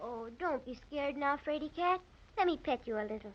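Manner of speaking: calm the frightened kitten; soothing. Acted. Girl softly soothes the frightened kitten.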